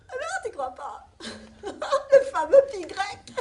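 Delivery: high, laughing, near tears; laughing disbelief. Acted. Mother marvelling at the river fact, laughing disbelief. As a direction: laugh through the words in amazement. Amazed by the pi discovery.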